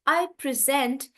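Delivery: "present" with a stressed second syllable; stress on SENT. Shown as a demonstration. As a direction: hit SENT in "present", as a verb. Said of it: "the stress is on sent".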